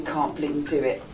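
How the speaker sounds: ordinary delivery, nothing marked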